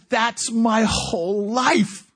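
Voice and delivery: high, slow, emphatic realisation; stunned recognition. Retold or imitated. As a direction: act out seeing yourself in the story. Prisoner recognises himself in Gideon.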